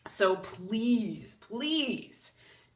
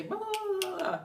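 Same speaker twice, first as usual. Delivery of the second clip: much higher, sing-song blah blah; mocking higher self; 12 semitones higher than usual. The boss's higher self mocking the gossip, high-pitched.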